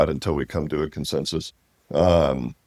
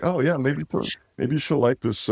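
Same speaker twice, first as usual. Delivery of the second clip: hopeful mock musing; 8 semitones higher than usual. His own hopeful thought retold.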